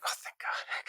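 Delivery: breathless whisper of relief; relieved whisper. Retold or imitated. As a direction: whisper it like the weight just lifted. Relief after director's approval.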